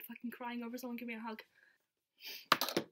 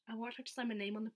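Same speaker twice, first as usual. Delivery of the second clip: normal talking voice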